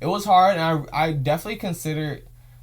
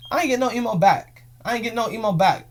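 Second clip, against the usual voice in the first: high, louder and indignant; complain, wronged and fed up; 7 semitones higher, 5 dB louder than usual. Real, not acted. Indignant repetition.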